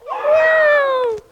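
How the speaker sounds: high, loud falling meow; scared cat meow. Pretend. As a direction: squeal it like a frightened kitten. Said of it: "And then it's a scared cat".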